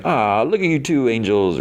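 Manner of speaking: high-pitched teasing coo; mock cooing. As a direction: coo over a couple, hamming it up. Teasing two friends as sweethearts.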